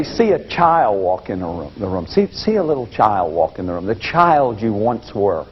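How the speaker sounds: low, soft, tender coaching voice; gentle guiding. Retold or imitated. Therapist guides the group to picture their child self, low and soft. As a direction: lead them softly toward their inner child. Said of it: "but I say".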